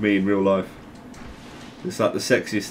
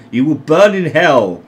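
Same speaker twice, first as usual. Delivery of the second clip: slow, louder mock condemnation; mock damnation; 5 semitones higher, 6 dB louder than usual. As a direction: imitate a zealot pronouncing doom. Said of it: "They go".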